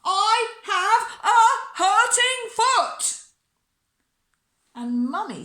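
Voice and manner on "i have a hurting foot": high, slow, wailing shout. A narrator's reading. Bella, a long way behind, calls to Mummy, shouting. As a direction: shout it far ahead, each word drawn out. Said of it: "so I had to shout".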